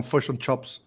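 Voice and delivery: "fush and chops" with Kiwi vowels; lightly imitated Kiwi "fush and chops"; New Zealand pronunciation. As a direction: say "fush and chops" with short i turned to u. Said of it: "that's very New Zealand".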